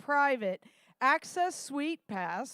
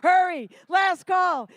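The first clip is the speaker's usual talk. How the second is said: loud last call; 4 semitones higher, 7 dB louder, slower than usual. Real, not acted. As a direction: hurry them, slow and loud. Closing the bidding.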